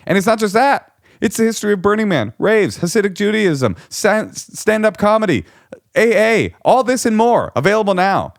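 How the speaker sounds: high, bright-pitched commercial pitch; mock ad read. Author pitching his book as a hammed-up commercial. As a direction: sell the book like an announcer. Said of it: "Something like that".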